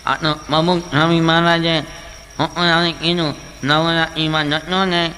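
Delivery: slurred, nasal, slow mumble; nasal mumbling speech. Retold or imitated. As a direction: talk through the nose, unclear. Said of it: "નાકમાંથી બોલે". (નાકમાંથી ગૂંગણું બોલવું)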